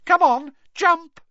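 high, loud mermaid goading; piercing playful taunt; malicious taunting. A narrator's reading. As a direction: egg him on cruelly, high and loud. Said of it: "she giggled maliciously".

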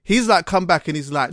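ordinary talk, nothing marked

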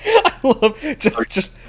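high, loud burst of laughter into the words; laughing. Real, not acted. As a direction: crack up, then squeeze the words out through laughter. Cracks up at co-host's blunt line.

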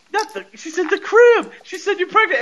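shocked realization. Retold or imitated. Geraldine realizing the pregnancy.

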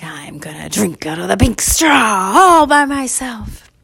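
slow, drawn-out mock triumph; gleeful gloating. Boy alone with the pink straw, gleeful gloating. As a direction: stretch out the words with greedy glee.

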